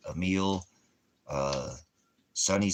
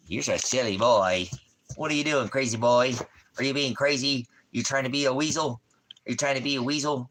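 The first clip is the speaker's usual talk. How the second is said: playful pet talk; 4 semitones higher than usual. Real, not acted. Owner teasing his wriggling dog in higher baby talk.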